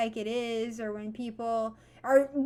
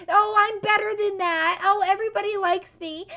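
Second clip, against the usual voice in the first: a hammed-up smug boast, pitched up and louder; mocking smug brag; 6 semitones higher, 4 dB louder than usual. Mocking an egotist who brags about impatience, smug. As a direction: sneer it like a self-satisfied show-off. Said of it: "with the ego up your ass".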